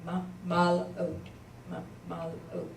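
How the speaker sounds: each syllable said separately with a pause; syllables split apart. Shown as a demonstration. Teacher showing students where the syllables break, pausing between them. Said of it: "the last syllable would be oat".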